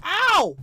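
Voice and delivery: loud high yelp of pain; sharp high ow. Real, not acted. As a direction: cry out as if hurt. Getting hit in game.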